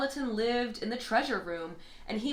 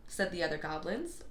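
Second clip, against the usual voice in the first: plain reading voice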